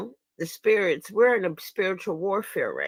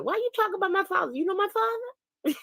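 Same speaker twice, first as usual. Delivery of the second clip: indignant comeback; 12 semitones higher than usual. Acted. Indignant retort to trolls about father.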